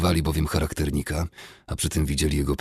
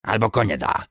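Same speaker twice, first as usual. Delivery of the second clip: goblin voice; 5 semitones higher than usual. A narrator's reading. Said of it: "zaproponował".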